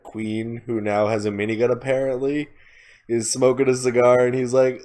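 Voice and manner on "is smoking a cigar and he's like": usual speaking voice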